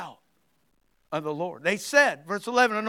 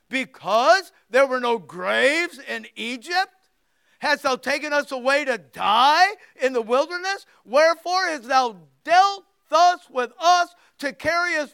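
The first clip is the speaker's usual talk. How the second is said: whiny complaint; 4 semitones higher, 7 dB louder than usual. Acted. Israelites complaining to Moses.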